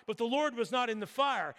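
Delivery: normal talking voice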